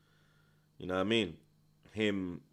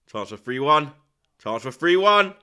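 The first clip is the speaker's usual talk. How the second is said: each repeat louder and higher; building excitement; 4 semitones higher, 11 dB louder than usual. Real, not acted. Fan watching a chance for three one, building to a shout. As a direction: rise from a mutter to a shout.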